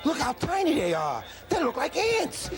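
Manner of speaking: high excited shout; wide-eyed, shouting amazement. Acted. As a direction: blurt it out, amazed at the view. Sees tiny horses from stands.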